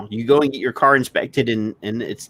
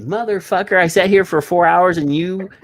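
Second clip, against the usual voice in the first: a higher, heated curse at the mechanic; retold angry outburst; 8 semitones higher than usual. Telling off the mechanic who wasted four hours, heated curse. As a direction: blurt it out in mock fury. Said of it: "I'm sorry I said motherfucker".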